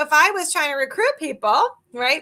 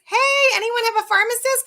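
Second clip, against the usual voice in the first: high, loud call to the crowd; 9 semitones higher, 4 dB louder than usual. Acted. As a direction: shout the question out to everyone. Calling out to the audience.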